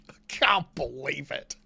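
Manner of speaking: slow whispered disbelief; whispering. Real, not acted. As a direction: hush it, barely voiced. Disbelief at game bots.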